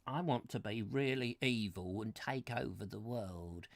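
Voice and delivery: low, soft put-on villain voice; would-be evil person. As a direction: voice an aspiring villain, low and quiet. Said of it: "somebody who wants this".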